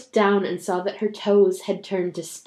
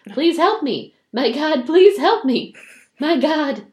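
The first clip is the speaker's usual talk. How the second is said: loud, higher begging prayer; desperate plea; 6 semitones higher, 5 dB louder than usual. Acted. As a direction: beg God with all your heart. Mother begs God in story.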